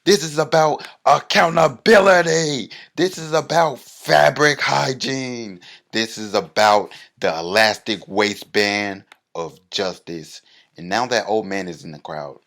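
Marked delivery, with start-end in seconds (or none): 0.0-10.4 high, loud rally shout; full-throated protest chant. Retold or imitated. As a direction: bellow it at the crowd like a protest leader. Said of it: "raise my sign and shout".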